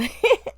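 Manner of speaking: squealing laugh; a quick high laugh; high burst of laughter. Real, not acted. Laughing at own remark.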